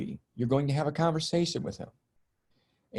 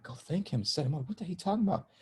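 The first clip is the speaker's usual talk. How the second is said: soft, hushed repeat turning incredulous; disbelieving echo; 5 semitones higher, 6 dB quieter, faster than usual. Retold or imitated. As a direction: echo it back like you can't believe it. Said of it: "And I'm like".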